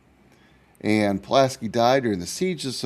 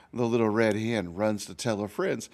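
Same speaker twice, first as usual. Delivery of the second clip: put-on lively storybook narration for kids; reading to children; 4 semitones higher than usual. A narrator's reading. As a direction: read it like a picture book to a class. Teacher reads story to children.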